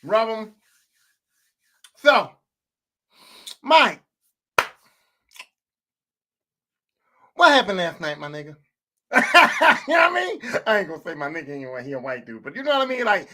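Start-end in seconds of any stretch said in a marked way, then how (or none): 2.0-8.6 halting mock-interrogation, trailing down low; demonstrating a stern boss's questioning; boss opening meeting. Shown as a demonstration. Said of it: "This is how the meeting would go". 9.1-10.4 cracking up at own joke; amused laughter breaking the act; laughing. Real, not acted. Cracks up at his own bit.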